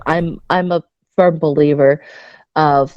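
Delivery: ordinary delivery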